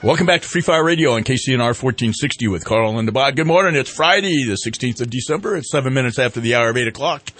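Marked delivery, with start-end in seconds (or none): none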